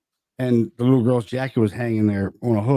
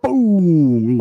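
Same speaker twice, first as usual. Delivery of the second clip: drawn-out boom, pitched up; imitated boom; 6 semitones higher, 5 dB louder than usual. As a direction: stretch out a booming sound effect. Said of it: "then you hear boom".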